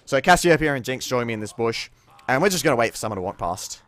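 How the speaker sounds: ordinary talk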